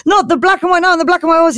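high, fast mock disbelief; hammed-up amazement at full tilt; incredulous goblin. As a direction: blurt it out as a starstruck question.

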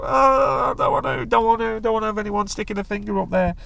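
exaggerated whiny imitation of a reluctant man; whinging moan. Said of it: "a whinging idiot, a moaning a lot".

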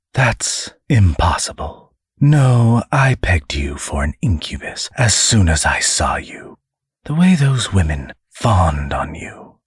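whispered demon menace; menacing demon. Acted. Demon accuses the angel.

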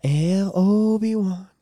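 slow, drawn-out call; lightly acted, lingering call of a name; imitated film line. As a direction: mimic the scene, stretching the name out long. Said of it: "when she's doing the thing".

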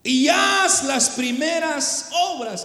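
slow, emphatic Bible verse with drawn-out words; Scripture recitation. Acted.

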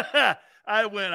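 ordinary delivery

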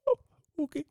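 tiny, very high fangirl squeak; starstruck fan. Acted. Fan invited to an interview by Charlie, mock starstruck squeak. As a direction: squeak it out, overcome and smitten. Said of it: "And I was like".

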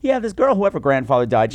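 usual speaking voice

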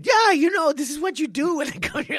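high loud shouted instruction; high loud coach voice; coach's loud advice. Retold or imitated. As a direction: bark it like an excited coach. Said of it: "he was telling me".

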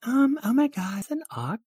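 very high-pitched put-on voice; startled onlooker. Onlooker spotting an ox on stage, high startled voice.

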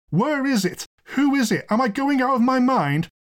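high, frantic questioning; lawyer's outburst. Acted. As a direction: fire off exasperated questions at a high pitch. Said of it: "broke out the lawyer".

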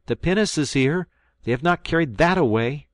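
high, loud joyful shout from afar; crying out. Acted. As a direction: boys shouting good news as they run up. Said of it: "my boys cry out".

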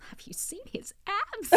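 excited whisper, voiceless and very soft; slow, secretive whisper about someone attractive; hushed confiding. Retold or imitated. Co-host whispering to her friend about a guest's abs, hushed. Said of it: "but Roxy was like".

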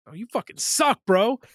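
hammed-up headset heckling; mock trash talk. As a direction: play at talking trash to other players. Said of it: "talk shit to people".

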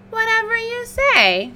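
high, airy motherly shrug; Mama Pig voice. Acted. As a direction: toss it off like a weary mother.

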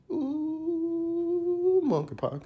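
sudden high falsetto swoop; falsetto ooh. Pretend. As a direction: squeal it way up high.

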